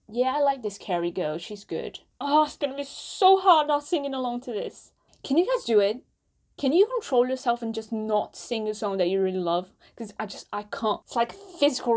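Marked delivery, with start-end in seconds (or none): none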